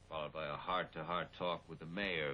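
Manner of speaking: usual speaking voice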